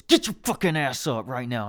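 forceful mock scolding; imitated angry mom. Mom waking hungover son at friend's house, imitated angry order. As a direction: order him up like a fed-up mom. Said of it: "just getting in my ass".

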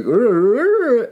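high wordless mumble, imitating a full mouth; mock mouth-full garble. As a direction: garble it as if your mouth were stuffed. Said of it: "It's hard to talk with my mouth full".

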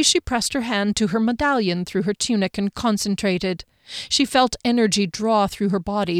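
usual speaking voice

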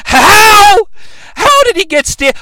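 high, loud yell of disbelief; incredulous outburst. Acted. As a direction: explode with exaggerated bafflement. Outraged disbelief at the plot.

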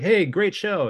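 supportive cheer. Retold or imitated. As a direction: chirp it like a cheerful pep-up. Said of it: "trying to be supportive".

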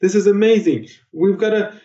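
high, excited nagging impression of Joe; hammed-up high voice of an insistent co-host; mimicking Joe. Mimicking the co-host nagging about recording time. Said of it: "Joe's like".

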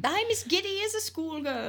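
high, drawn-out giddy voice; giddy Scrooge. Acted. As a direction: play an old miser overcome with glee. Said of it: "He gets all excited".

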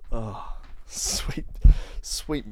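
hushed, breath-only disbelief; mock-horrified whisper. Comic finding Ken Dodd on the list, aghast mock whisper. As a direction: whisper it as if appalled.

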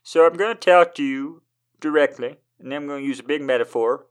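lightly voiced fast pompous poem; a show-off poem explaining itself; the poem talking. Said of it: "they're like".

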